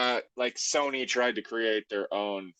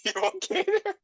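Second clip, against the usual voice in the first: very high falsetto question; teasing falsetto check-in; mock falsetto concern; 3 dB louder, faster than usual. Real, not acted. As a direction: squeak the question like a cartoon. Teasing the other speaker.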